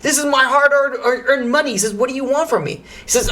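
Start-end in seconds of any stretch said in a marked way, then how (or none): 0.0-1.7 high, indignant retold defence; getting upset. Zev defending his hard-earned money, getting upset. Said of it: "Zev starts getting upset". 1.9-2.8 mildly indignant, raised pitch; getting upset. Retold or imitated. Zev challenging the friend asking for help, getting upset. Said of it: "Zev starts getting upset".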